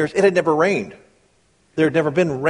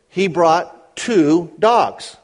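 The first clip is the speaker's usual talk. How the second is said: slow, weighty punchline; slow each word down to land it; slow emphasis; 3 semitones higher, 3 dB louder, much slower than usual. Acted.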